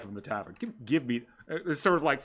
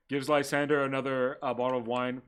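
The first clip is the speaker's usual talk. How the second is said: usual speaking voice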